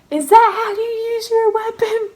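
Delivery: high, loud mock scolding; retold sergeant outrage. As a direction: imitate an incredulous sergeant telling someone off.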